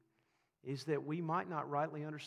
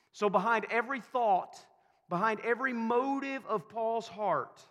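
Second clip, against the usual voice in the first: raised preaching; 8 semitones higher, 8 dB louder than usual. Acted. Preaching at full force.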